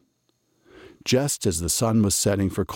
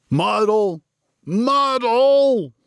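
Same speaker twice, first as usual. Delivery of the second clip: much higher, louder with each call; building shout; 6 dB louder, much slower than usual. A narrator's reading. Reb Stein storming into the bakery, shouting. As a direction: bellow his name across the bakery. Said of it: "Reb Stein shouted".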